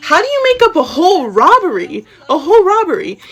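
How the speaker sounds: high, incredulous half-laughing exclamation; laughing, scandalized outburst; laughing disbelief. Real, not acted. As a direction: swoop up high in outraged disbelief. Incredulous at the invented robbery.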